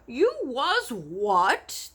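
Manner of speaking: slow, loud, high disbelief; shocked cry. Acted. BFG horrified that Sophie was eaten. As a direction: cry out in alarm. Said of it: "the B F G cried".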